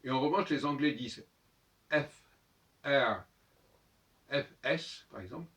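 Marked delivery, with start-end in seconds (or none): 1.9-5.1 "F, R, S" as èf, èr, ès; name "F, R, S" with the E before the consonant; letter names, vowel first. Shown as a demonstration. Teacher comparing English with French, "F, R, S" with leading è. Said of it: "comme le français".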